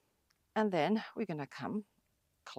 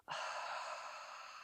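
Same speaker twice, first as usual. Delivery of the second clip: long breathy exhale sigh; dramatic sigh; 12 dB quieter than usual. Shown as a demonstration. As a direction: let all the air out with a sigh. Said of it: "Exhale through the mouth".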